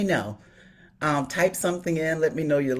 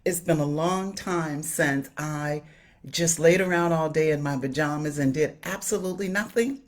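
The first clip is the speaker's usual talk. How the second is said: ordinary talk, nothing marked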